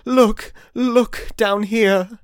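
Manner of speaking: high, slow urgent ghostly plea; urgent exclaiming. A narrator's reading. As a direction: call him to look down, urgently. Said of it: "exclaimed the ghost".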